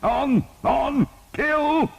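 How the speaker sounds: high, loud rising bellow; battle cry. A narrator's reading. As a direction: drive the men forward. Bishop urging on charge.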